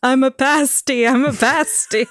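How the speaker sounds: high, loud mock protest; mock protesting Oswald. Co-host punning on Lee Harvey Oswald's plea, loud mock protest. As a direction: cry it out like a wrongly accused man. Joke imitation of Oswald's "I'm a patsy.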